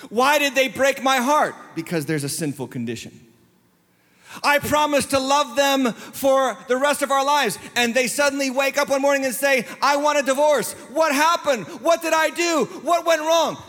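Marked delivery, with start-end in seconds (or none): 0.1-1.5 higher-pitched wounded question; dramatized aggrieved plea; acted heartbroken protest. Voices a heartbroken person's question. 4.4-13.6 high, insistent wounded questioning; building to pleading, high-pitched disbelief; acted anguished bewilderment. Voices a spouse facing divorce.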